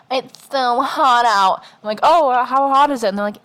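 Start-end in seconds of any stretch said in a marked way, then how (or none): 0.1-1.6 mocking whiner. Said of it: "tired of everyone being like". 2.0-3.1 mock innocent question. Mocking question to complainers.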